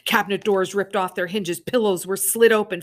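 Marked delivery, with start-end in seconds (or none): none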